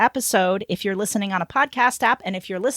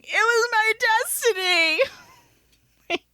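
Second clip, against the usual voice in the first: mock tearful outburst; 11 semitones higher, much faster than usual. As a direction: wail it with hammed-up tears. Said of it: "I break down in tears".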